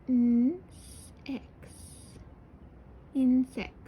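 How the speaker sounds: stretched Insects to hear each sound; said really slowly. Shown as a demonstration. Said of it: "really slowly".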